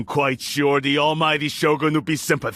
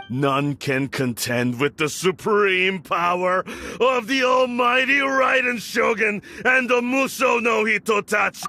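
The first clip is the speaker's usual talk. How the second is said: fanatical proclamation; 8 semitones higher than usual. Acted. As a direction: proclaim it like a zealot at the peak. Lord extols the Shogun's power.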